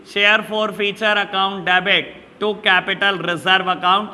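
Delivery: very slow, word by word, as if dictating notes; teacher dictating an accounting entry slowly; slow dictation. Shown as a demonstration. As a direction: spell out the journal entry slowly for students to write.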